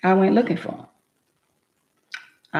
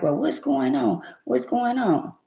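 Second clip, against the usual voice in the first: mild mock hysteria; 7 semitones higher than usual. Wife retelling her panic over his grazed head, mildly hysterical. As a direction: act out a touch of hysteria in a high voice. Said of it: "I'm all hysterical, hollering and screaming".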